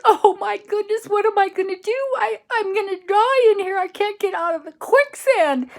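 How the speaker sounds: very high, loud, laughing panic; panicked cry. Acted. Tom, stuck in quicksand, wails to his friends in panic. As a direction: wail as if sinking for real, with a laugh.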